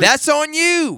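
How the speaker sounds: very high, emphatic verdict; indignant scolding. Real, not acted. Host putting the blame on the player, high-pitched and indignant. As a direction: throw the blame back, pitch way up.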